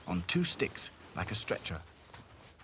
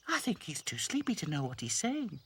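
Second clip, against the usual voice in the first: high doll voice; 8 semitones higher than usual. Acted. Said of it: "said Lucy".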